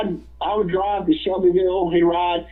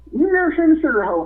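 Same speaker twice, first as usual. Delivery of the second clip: teasing George; 9 semitones higher, faster than usual. Retold or imitated.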